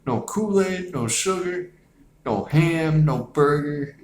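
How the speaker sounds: whiny, squeaky voice, pitch way up; high-pitched stoned complaint. Retold or imitated. Retelling the munchies scene, whiny high-pitched complaining. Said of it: "He's like".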